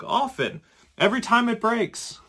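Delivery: high, deadpan monk answer; monk's reply. Acted. Monk casually answering the scared tourist, high and dry. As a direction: answer the nervous tourist like it's obvious. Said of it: "says the monk".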